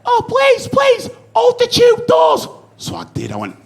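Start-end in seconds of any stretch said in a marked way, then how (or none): 0.1-2.5 high, drawn-out desperate plea; much higher, slowed mock plea from a stranger; pleading woman. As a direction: beg like a frantic mum with a pram. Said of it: "I just heard it background".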